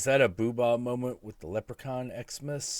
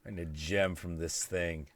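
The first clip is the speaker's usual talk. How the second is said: normal talking voice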